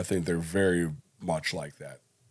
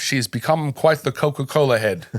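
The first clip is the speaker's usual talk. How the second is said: higher, louder put-on Australian accent; 4 semitones higher, 6 dB louder than usual. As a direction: play a broad Australian bloke. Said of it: "apparently I'm Australian as well".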